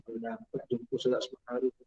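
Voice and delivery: soft, awed murmur of the wish; hushed awe. Acted. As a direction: drop to a near-hush at the marvel.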